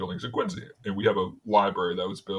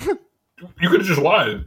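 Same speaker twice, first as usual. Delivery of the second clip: slow, higher, louder mock disbelief; incredulous vendor; 9 semitones higher, 8 dB louder, much slower than usual. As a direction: drag it out like a stunned friend.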